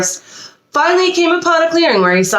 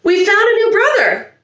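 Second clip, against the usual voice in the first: much higher, excited swan voices; happy honking; 11 semitones higher than usual. A narrator's reading. Young swans call to their mother, much higher and excited. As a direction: call out bright and glad. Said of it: "honked the happy swans".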